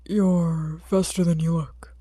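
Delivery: slow, low grumble; a tired man grumbling slowly; grumbling hero. A narrator's reading. Eraserhead grumbles at the boy, slow and low. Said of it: "Eraserhead grumbles".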